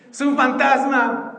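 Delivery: high, loud and slow, panicked; frightened cry. Acted. Disciples crying out to each other, high frightened cry. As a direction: cry out in terror. Disciples see Jesus, terrified.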